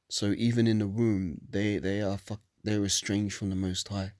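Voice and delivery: normal talking voice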